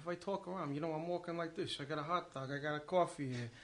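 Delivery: talky New York street-guy accent. Shown as a demonstration. Comic demonstrating accents to host, rambling New York accent. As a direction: play a New Yorker out walking. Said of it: "I do a New York accent".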